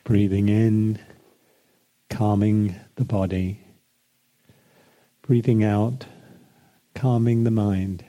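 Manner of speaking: unhurried meditation voice with long pauses; guided meditation. Acted. Leads a breathing meditation.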